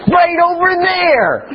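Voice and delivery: put-on sing-song toddler pointing; child's voice. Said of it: "And he's like".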